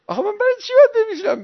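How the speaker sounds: high, laughing, incredulous why should I; a squeaky mock complaint, half laughing; mock indignant protest. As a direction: play up the outrage with a laugh. Mock protest at film committee.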